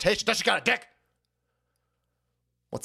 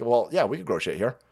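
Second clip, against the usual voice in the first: low confident farmer; 8 semitones lower, 5 dB quieter than usual. Retold or imitated. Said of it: "were like".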